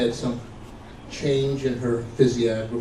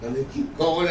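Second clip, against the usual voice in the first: ordinary delivery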